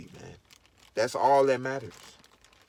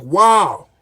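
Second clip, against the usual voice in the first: mock amazement; 4 semitones higher, 9 dB louder, slower than usual. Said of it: "And you gonna be like".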